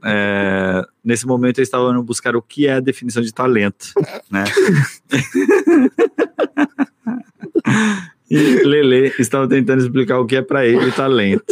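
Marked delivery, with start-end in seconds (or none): 5.1-6.8 squealing laugh; high burst of laughter. Real, not acted. Laughs mid-explanation. (gargalhada aguda)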